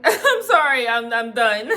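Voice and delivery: a mock tearful laugh of giving up; laughing mock apology. Quoting herself ending it.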